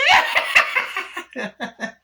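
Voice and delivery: high, loud cackle; mocking cackle. Chucky laughs at Tiffany with a high loud cackle. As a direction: cackle right in her face. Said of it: "Chucky mocks Tiffany".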